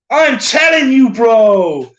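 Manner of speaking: exaggerated accent take-off, slow and punched; Marcelo. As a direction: have a go at mimicking his accent. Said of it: "I don't think I can replicate Marcelo's accent, but I will give it a go".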